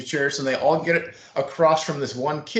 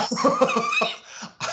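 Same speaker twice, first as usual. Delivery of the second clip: real laugh. Real, not acted. Laughing at the host's band name joke, real laugh. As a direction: burst of high laughter.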